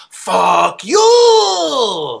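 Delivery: high, loud, long-drawn mimicry of a classmate; hammed-up imitation. Mimicking the kid who popped up in the library, hammed-up. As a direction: stretch it out into a loud, hammy high howl. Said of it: "kind of hamming it up".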